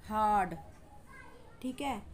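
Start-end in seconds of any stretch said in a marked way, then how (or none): 0.1-0.5 "हार्ड" with a long, falling aah; clearly stretched "हार्ड"; long A demo. Shown as a demonstration. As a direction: model the long vowel in "हार्ड". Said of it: "बहुत ही long sound".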